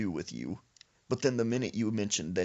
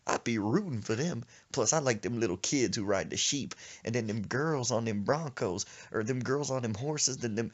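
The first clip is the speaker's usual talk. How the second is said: usual speaking voice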